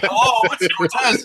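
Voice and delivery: high put-on voice as Cortez; mock Cortez voice. Impersonating Cortez on call.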